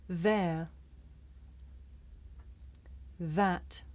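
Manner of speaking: Then. This. There. That. Other. Smooth. each said slowly with a clear voiced th; careful teacher model words. Shown as a demonstration. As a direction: model each word for learners to repeat. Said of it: "Listen and repeat".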